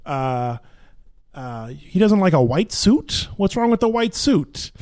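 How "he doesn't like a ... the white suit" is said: higher-pitched Brooklyn stage line; indignant question in a Brooklyn accent. Acted. Actor recalling lines from a play, Brooklyn accent. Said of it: "a line I used when I was in the play".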